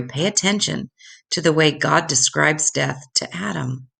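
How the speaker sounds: ordinary delivery, nothing marked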